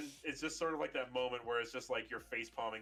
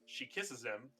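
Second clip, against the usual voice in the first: ordinary talk, nothing marked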